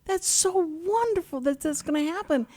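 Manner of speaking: high, bright-eyed exclaiming of delight; delighted exclamation. Retold or imitated. Retelling her reply to Roger, high delighted exclaiming. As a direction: gush with joy at the news.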